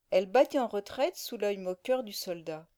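ordinary delivery, nothing marked

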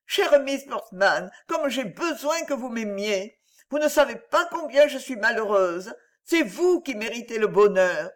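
put-on a tender young woman baring her unhappiness; young heroine Virginie, distressed. Virginie begs Miss Portman for her love, sorrowful and high.

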